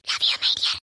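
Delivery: a secret goodbye no one should hear; whispered. Acted. Girl whispering love to her absent hero before college, hushed.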